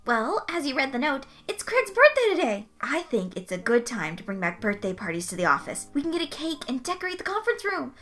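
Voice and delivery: high, eager and bubbly coworker; excited Butters. Acted. Butters pitching a birthday party to coworkers, excitedly high. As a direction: gush the party plan with wide-eyed glee.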